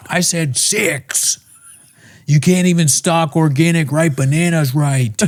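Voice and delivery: high, slow scolding husband; mock abusive husband. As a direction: scold her like a petty tyrant. Voicing abusive husband Tom.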